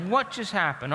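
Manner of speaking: a put-out mock complaint; frustrated. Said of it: "and I'm frustrated".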